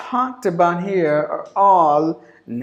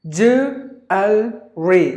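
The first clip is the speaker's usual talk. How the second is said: syllable by syllable; 3 dB louder, much slower than usual. Shown as a demonstration. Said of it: "There are only three syllables in this word".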